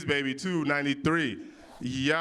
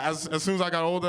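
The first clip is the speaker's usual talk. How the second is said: usual speaking voice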